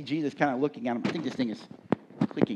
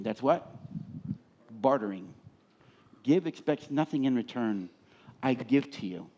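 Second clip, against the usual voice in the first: usual speaking voice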